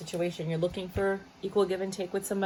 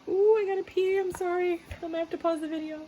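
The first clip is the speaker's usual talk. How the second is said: sudden squeal; 11 semitones higher than usual. Real, not acted. Sudden need to pee.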